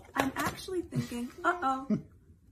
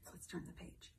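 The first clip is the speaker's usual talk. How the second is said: whisper; 4 semitones lower, 17 dB quieter than usual. Acted. Storybook page turn whisper.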